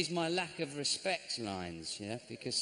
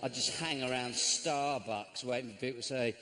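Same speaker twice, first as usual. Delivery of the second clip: usual speaking voice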